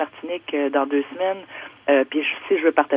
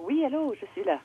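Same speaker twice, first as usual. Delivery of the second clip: over a landline phone; 9 semitones higher, 6 dB quieter than usual. Real, not acted. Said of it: "on va aller au téléphone".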